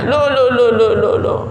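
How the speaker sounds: high, rapid string of protests; startled objection. Acted. Doubter questioning that God never wrongs people, high startled objection. Voices an objector to the preacher's claim.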